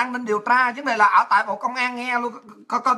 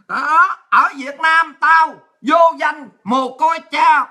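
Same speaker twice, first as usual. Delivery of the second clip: very slow, loud, word by word; defiant slow dictation; 4 dB louder, much slower than usual. Retold or imitated. Lisa writing her statement for police, slow defiant dictation. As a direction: spell it out slowly and forcefully for the record.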